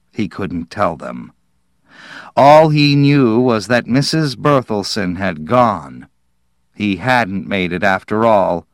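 plain reading voice

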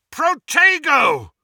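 loud, high, drawn-out battle cry; roared spell. A narrator's reading. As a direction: roar the spell across the hall. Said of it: "roared Harry".

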